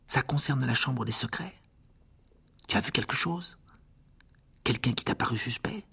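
chuchoté, en confidence; à voix basse. Acted. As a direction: questionner tout bas. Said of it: "parla à voix basse". (à voix basse)

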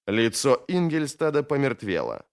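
plain reading voice

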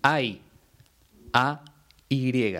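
each letter of "A Y" named clearly and apart; careful teacher spelling of "A Y"; spelled out. Shown as a demonstration. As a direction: say "hay" and then spell it "A Y" letter by letter. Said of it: "la interjección".

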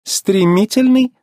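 high, slow, sceptical question; drawn-out doubting question, rising; doubtful. A narrator's reading. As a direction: echo it slowly in disbelief. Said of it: "усомнилась Фло".

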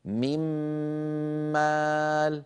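"من مال" said as one long humming doubled m, no n; noon into meem. Shown as a demonstration. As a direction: hold the m between "من" and "مال". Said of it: "فصار النطق هكذا".

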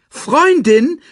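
high, loud indignant question; snorting disbelief. A narrator's reading. Timon hears Nala is a friend, snorting disbelief. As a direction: snort the word back in disbelief. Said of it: "schnaubte Timon".

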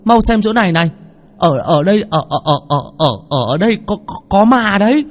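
high, stammering terrified voice; frightened stammer. Acted. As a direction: stutter it out in panic. Frightened woman stammering about ghosts.

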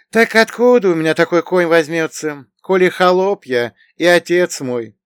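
high, loud complaining voice; gloomy companion. A narrator's reading. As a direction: protest your lot in a high, aggrieved voice. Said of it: "посмурнев, произнёс он".

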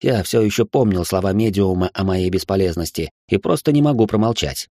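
ordinary delivery, nothing marked

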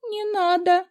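high frightened squeak. A narrator's reading. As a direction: pip it out in fright. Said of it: "пискнула".

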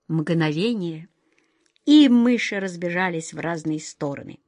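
ordinary delivery, nothing marked

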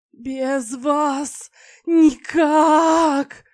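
high, loud, slow wailing howl. Acted. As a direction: wail it out in a shifting voice. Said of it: "выл на разные голоса".

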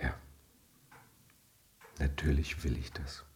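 low whispered assurance; whispered promise. Acted. Husband promising his wife, low whispered assurance. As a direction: murmur it low and earnest.

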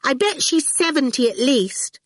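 put-on high-pitched cheeky child's retort. A narrator's reading. Awful guesses Dillion's age, rudely retorting. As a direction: toss it out high and rude. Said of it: "Awful retorted".

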